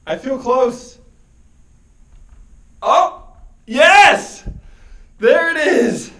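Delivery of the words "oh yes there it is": excited relief. Real, not acted. Reaching the end of the maze.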